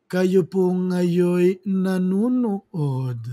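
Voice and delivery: low, very slow announcer delivery. Retold or imitated. Vlogger imitating a slow-talking debate candidate, low and very slow. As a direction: stretch every word like a sleepy radio host. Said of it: "Parang ganun siya magsalita".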